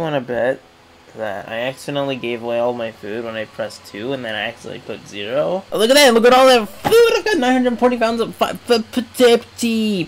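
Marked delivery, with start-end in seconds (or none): 5.7-10.1 high, loud amazement; excited gushing. Real, not acted. Player seeing all his food, excited high babble. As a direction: burst out, stumbling over the words. Marvels at huge food pile.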